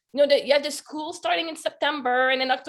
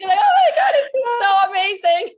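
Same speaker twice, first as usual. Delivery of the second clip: high, loud, tearful delight; nearly crying from joy; thrilled laughing shout; 8 semitones higher, 7 dB louder than usual. Retold or imitated.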